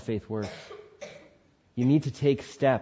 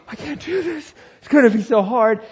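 panicked; 9 semitones higher, 4 dB louder than usual. Retold or imitated. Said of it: "I was like".

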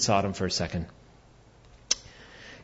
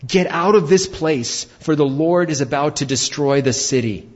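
raised, urgent call to flee; urgent warning; 4 semitones higher, 4 dB louder than usual. Acted. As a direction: warn them to get out now. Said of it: "said to his sons-in-laws".

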